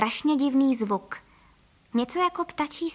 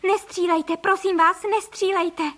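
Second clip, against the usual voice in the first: high, urgent begging; pleading cry; 7 semitones higher, 4 dB louder, slower than usual. A narrator's reading. Couple begging armed ducks not to shoot, crying out. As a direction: beg loudly, pitch raised. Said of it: "zvolali pan Frejka a paní Frejková".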